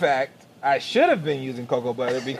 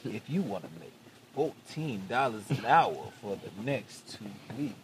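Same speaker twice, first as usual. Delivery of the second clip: late night DJ voice; 7 semitones lower, 14 dB quieter than usual. Pretend. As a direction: purr it like a night DJ. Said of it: "I used my telephone like a talk".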